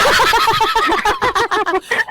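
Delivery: high burst of laughter. Real, not acted. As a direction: crack up at the joke. (malakas at mataas na tawa)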